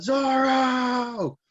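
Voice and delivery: very high, long-held cry; yelled name. Retold or imitated. Recapper as Luffy calling his struck crewmate, yelling. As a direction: hold the last vowel in a desperate call. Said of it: "like, yells out".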